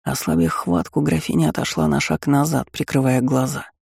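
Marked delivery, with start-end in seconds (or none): none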